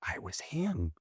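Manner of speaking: unvoiced, soft whisper; whispered confession. Real, not acted. Admitting to the host in a whisper. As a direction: let it drop to a whisper of realization. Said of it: "repeats the line".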